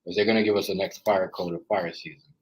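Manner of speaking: ordinary delivery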